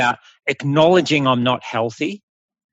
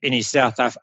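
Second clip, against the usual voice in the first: ordinary talk, nothing marked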